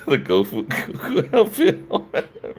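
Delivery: laughing through the words; cracking up mid-sentence. Real, not acted. Laughing about Goku outfit.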